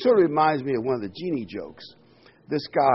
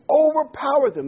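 overpowered hit high and loud, falling; dramatic scripture emphasis; emphatic reading; 13 semitones higher, 8 dB louder than usual. Acted. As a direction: punch out the word overpowered.

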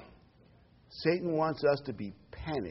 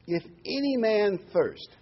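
"any" pitched high and drawn out; stress on ANY; 9 semitones higher, slower than usual. Real, not acted. Preacher quoting Jesus to the congregation, stressing "any". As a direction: lean slowly and high on "any". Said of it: "I want to emphasize that word".

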